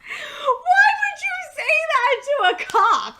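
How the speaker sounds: high, loud disbelieving cry; laughing incredulous shout. Real, not acted. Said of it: "And I'm just like".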